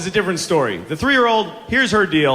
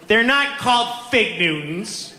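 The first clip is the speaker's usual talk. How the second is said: put-on slow, high, emphatic child voice; drawn-out, higher-pitched little-kid insistence; insistent three-year-old; 5 semitones higher, slower than usual. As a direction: correct a parent with toddler certainty. Toddler correcting dad.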